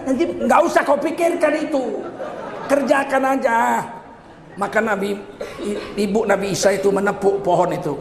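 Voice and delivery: ordinary delivery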